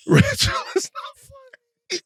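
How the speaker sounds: wheezing laughter through the words; can barely speak for laughing; laughing helplessly. Real, not acted.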